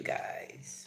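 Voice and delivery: breathy whispered tag word; whispered aside. Real, not acted. Vlogger whispers an aside to her viewers. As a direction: drop to a whisper on the last word.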